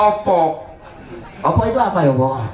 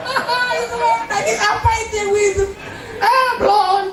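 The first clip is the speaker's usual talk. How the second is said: high scoffing mockery; high mocking scoff; faster than usual. Real, not acted. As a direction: scoff at the fake tears. Mocking fake crying.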